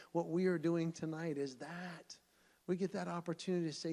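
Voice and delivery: usual speaking voice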